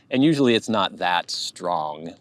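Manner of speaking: ordinary delivery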